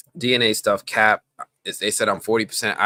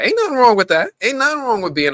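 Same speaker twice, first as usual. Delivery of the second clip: much higher, loud defensive quip; mock defensive; 7 dB louder than usual. Real, not acted. To a chatter claiming full European, high loud mock defence. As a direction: shoot back high and loud, half joking.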